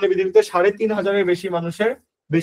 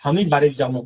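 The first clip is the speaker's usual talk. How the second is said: lower-pitched Bogura regional speech; Bogura dialect; 4 semitones lower than usual. Shown as a demonstration. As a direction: say it as a Bogura local would. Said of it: "এটা হলো বগুড়ার ভাষা".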